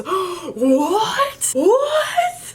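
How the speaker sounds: the niece squeals in stunned disbelief; shocked niece. Retold or imitated.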